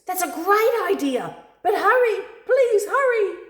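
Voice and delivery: very high, loud, urgent; frantic pleading. Acted. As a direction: beg them to hurry like a panicked bird. Frantic bird urges hurry.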